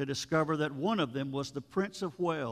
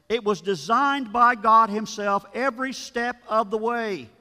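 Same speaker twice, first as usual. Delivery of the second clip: rising, louder pulpit emphasis; fervent preaching; 4 semitones higher, 4 dB louder than usual. Acted. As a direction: drive the point home to the congregation. Preacher presses the point.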